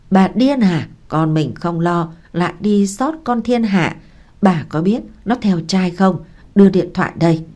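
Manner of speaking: plain reading voice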